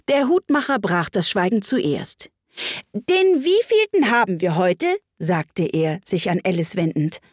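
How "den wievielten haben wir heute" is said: high-pitched, sudden question; eager storybook Hatter, pitched up; inquiring Hatter. A narrator's reading. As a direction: demand the answer in character.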